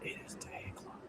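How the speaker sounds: hushed whisper; soft late night whisper. Acted. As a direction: whisper it like a secret.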